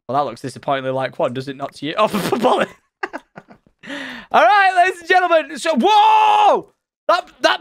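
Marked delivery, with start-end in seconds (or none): 4.3-7.6 high showman's shout; loud, pitched-up whoop of amazement; excited announcement. Real, not acted. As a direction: holler it like a ringmaster, amazed. Showman announcing a surprise find.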